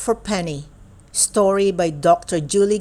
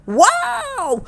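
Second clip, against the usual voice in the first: high excited holler; hollered wow. Acted. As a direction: holler it in sheer delight. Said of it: "I holler".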